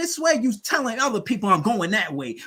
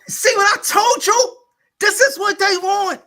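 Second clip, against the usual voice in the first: loud, high-pitched exasperation; exasperated outburst; 12 semitones higher, 7 dB louder than usual. Real, not acted. Arguing with a fellow fan about what they want, exasperated outburst. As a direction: burst out high and loud, fed up.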